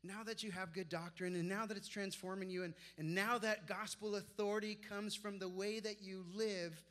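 preaching Paul's words. Acted. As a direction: read Paul's charge with rising fervour. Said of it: "He says".

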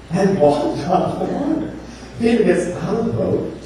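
ordinary talk, nothing marked

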